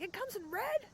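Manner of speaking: very high mock yell, kept soft; retold excited yell. Retelling spotting another keyboard in red, excited mock yell. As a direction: replay the amazed squeal, pitched right up. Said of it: "and I yelled".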